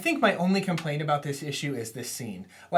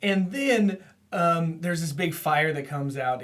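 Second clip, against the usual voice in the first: usual speaking voice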